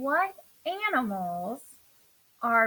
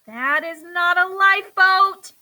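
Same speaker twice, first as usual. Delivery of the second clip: louder, higher and slow protest; shouting; 6 semitones higher, 10 dB louder than usual. Acted. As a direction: shout it back, slowly insisting. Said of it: "shouted Buddy".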